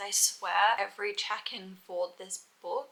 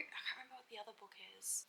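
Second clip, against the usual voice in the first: whispered aside; 2 semitones higher, 15 dB quieter, much faster than usual. Real, not acted. Muttered aside to herself.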